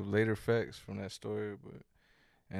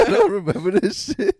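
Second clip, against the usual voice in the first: laughing hard, pitched up and loud; 10 semitones higher, 12 dB louder than usual. Real, not acted. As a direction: burst out laughing mid-line. Laughing at forgetting crash.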